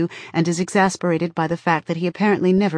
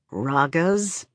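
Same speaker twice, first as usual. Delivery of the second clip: disgusted. A narrator's reading. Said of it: "the word sounds like filth in his mouth".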